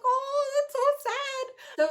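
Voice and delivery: high, drawn-out, choked-up imitation of herself; mock tearful reaction. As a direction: replay the moment you nearly cried, slowly and high. Retelling her own emotional reaction.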